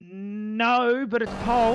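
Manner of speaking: high-pitched finish-line call; excited race call. Real, not acted. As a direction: call the pole lap with real excitement. Calling pole position at the line.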